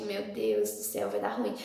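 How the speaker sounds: low, soft muttered worry; inner dread. Retold or imitated. Daughter dreading mother's reaction, low worried mutter.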